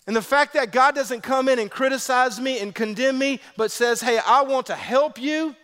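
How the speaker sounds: high, loud preacher's drive; full-force preaching. Acted. Pastor preaching to the congregation, full force.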